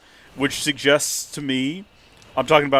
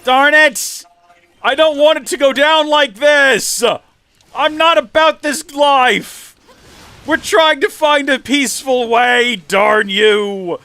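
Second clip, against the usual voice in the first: hammed-up louder, higher cries of protest; mock anguished shouting; 11 semitones higher, 8 dB louder, slower than usual. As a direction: rail at the fight like a reluctant hero. Hammed-up outburst at the enemies.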